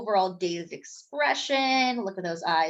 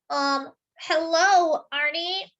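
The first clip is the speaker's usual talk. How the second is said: slow, hesitant, higher hello; stammering; 6 semitones higher, slower than usual. Acted. Mister Bing stammering at a talking donut. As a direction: trip over the words nervously. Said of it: "Mister Bing stammered".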